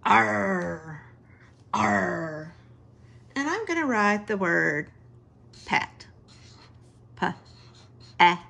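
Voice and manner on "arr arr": a light pirate growl on arr. Shown as a demonstration. Teacher to class, showing the ar sound: light pirate arr. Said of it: "it's what the pirate says".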